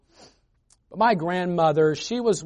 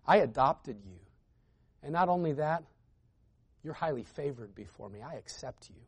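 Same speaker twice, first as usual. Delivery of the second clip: soft, tender, reassuring and much softer; gentle divine reassurance, softer toward the end; tender voice of God; 2 semitones lower, 8 dB quieter than usual. Retold or imitated. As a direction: speak as a loving father comforting a child. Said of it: "God says".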